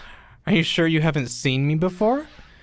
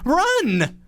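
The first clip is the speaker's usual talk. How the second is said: urgent shout; 12 semitones higher than usual. Acted. Urging him to flee.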